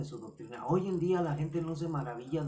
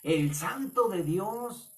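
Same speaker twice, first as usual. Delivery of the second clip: high, drawn-out demon voice; unclean spirit; 7 semitones higher, much slower than usual. Acted. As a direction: slowly cry it out as a demon. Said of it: "el espíritu inmundo le dijo". (voz aguda y lenta de demonio)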